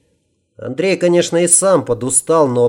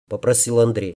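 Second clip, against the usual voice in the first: plain reading voice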